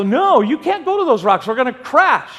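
loud, high mock-panicked objection; panicked protest. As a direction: shout him down in retold alarm. Retold quarrel, about to crash.

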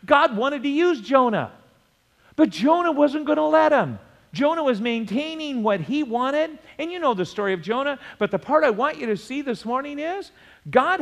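high, driving sermon delivery; urgent pulpit exhortation; impassioned preaching. Real, not acted. Preaches at full force.

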